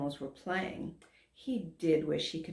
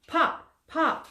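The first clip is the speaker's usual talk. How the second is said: popping sounds; 10 semitones higher, 5 dB louder than usual. A narrator's reading. Narrator imitates popping.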